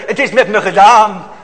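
loud, despairing outcry of a lost soul; anguished cry. Acted. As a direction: cry it out as a man who knows he's done for. Repeats the sinner's cry.

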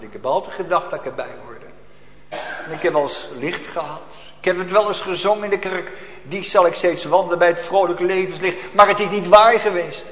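impassioned preaching, swelling and ebbing; fervent sermon. Acted. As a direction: preach it with urgency. Preaching with rising force. (vurige preek)

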